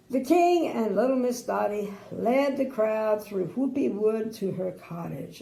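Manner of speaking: ordinary delivery, nothing marked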